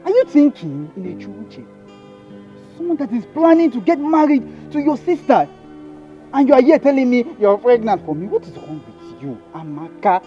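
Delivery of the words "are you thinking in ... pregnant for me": loud, higher furious rant; angry denial. Acted. Man yelling at his pregnant girlfriend in fury. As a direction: rage at her, loud and accusing.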